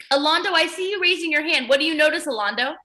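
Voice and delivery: ordinary delivery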